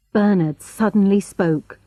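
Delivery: plain reading voice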